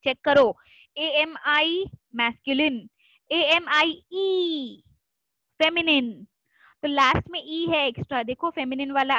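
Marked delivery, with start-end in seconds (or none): 3.3-4.8 "एएमआईई" spelt slowly, final E drawn out; stress the extra E. Shown as a demonstration. Teacher spelling the feminine word for class. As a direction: spell it slowly, stretch the end. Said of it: "has an extra E at the end".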